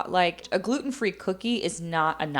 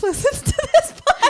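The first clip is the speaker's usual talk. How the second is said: words breaking up into laughter; laughing; 8 dB louder than usual. Real, not acted. Host realises her mom listens to the podcast, laughing. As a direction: crack up as you realise it. Bursts out laughing mid-sentence.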